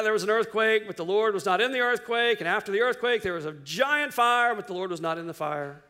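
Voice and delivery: normal talking voice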